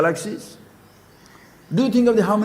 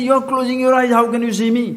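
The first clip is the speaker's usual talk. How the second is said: mock angry child; 6 semitones higher, 4 dB louder, faster than usual. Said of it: "He gets so angry with me".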